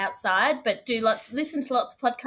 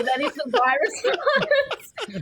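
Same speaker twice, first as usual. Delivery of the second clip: laughing; 5 semitones higher, 4 dB louder, much slower than usual. Real, not acted. Laughing about a bad footy team.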